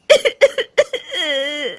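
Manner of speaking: high, acted crying; tearful wordless weeping; sobbing. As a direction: burst into sobs. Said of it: "Rajni रोने लगती है".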